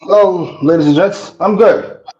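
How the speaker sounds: muffled, like speaking underwater. Real, not acted. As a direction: sound as if under water. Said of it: "why do you sound like that? You sound like you're underneath, underwater".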